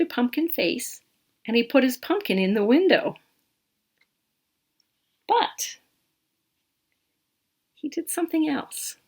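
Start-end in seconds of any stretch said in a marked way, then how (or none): 5.3-9.0 soft whispered story voice, like a secret; hushed storytelling. Acted. Reading to a child at bedtime, whispering the secret part.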